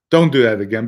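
loud, stern reprimand; retold stern warning. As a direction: act out laying down the law. Boss's retold warning to the teller.

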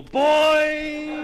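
loud, high, long-held boing; cartoon spring boing. Zebedee springs into the story, loud high boing. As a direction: imitate a bouncing spring at full voice.

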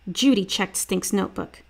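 usual speaking voice

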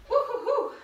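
high string of whoops; repeated whoops. Real, not acted. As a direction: cheer three times in a row. Cheering after a set.

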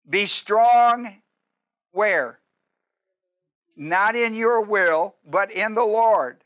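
slow, loud emphatic preaching; preaching at full force. Acted. Preacher to congregation, slow and loud. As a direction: drive each word home to the congregation.

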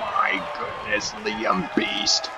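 deep crazy voice. Real, not acted. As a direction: voice suddenly drops very low. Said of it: "I just got all deep and crazy there".

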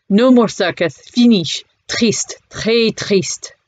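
put-on light French accent, sad and halting; broken French English. Cafe owner mourns the circus, light French accent. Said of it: "in broken English".

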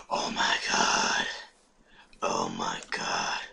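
slow, breath-only whisper of wonder; hushed, drawn-out awe; awed whisper. Real, not acted. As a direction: whisper it slowly in amazement. Awed by flowers.

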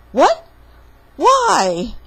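high, loud cartoon disbelief; shocked. Papa threatened with shark, shocked yelp.

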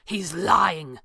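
mild hissed accusation. A narrator's reading. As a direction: hiss it in a character voice. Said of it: "Thatcher hissed".

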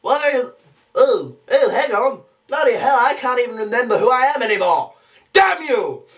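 high, flustered cartoon outburst; mounting exasperation to a cry; Stewie Griffin. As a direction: get muddled, then curse in a high voice. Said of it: "back over to Stewie Griffin".

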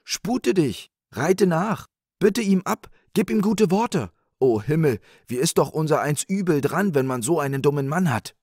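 high, loud, urgent scolding; wife calling out. Acted. Wife urging her husband after the old man, crying out. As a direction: order him about in a high cry. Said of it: "rief die Frau".

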